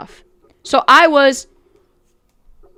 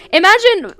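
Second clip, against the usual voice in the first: normal talking voice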